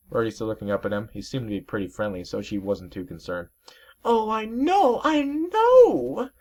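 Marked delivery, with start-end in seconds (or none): 4.0-6.3 very high, slow falsetto whine; a vain sea serpent sulking over his lost mustache; high, put-on whimpering voice; weepy dandy serpent. Serpent agrees.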